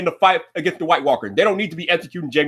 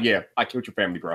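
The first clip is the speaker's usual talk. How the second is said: cold flat quote; 7 semitones lower, 6 dB quieter, faster than usual. Retold or imitated. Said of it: "she's such a cold bitch".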